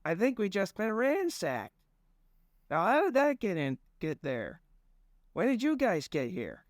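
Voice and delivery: a just-woken stranger, baffled and high; high dazed man voice. Acted. Narrator voicing the woken stranger, high and baffled.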